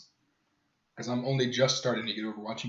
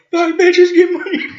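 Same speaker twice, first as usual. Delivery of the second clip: very high, loud mimicked shout; uncensored outburst; 16 dB louder, slower than usual. Sergey answering the meaning of life, uncensored shout. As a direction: blurt it at full volume, pitched way up. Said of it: "doesn't have a censor, so immediately".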